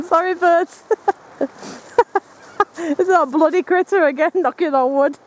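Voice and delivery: high-pitched giggling through the words; laughing. Real, not acted. Startled birds, giggling at himself.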